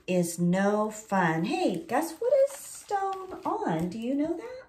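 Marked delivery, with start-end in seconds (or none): none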